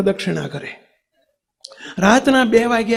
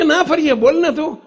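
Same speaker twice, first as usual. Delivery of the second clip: reassuring Bapa; 7 semitones higher than usual. Acted. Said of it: "બાપા કે".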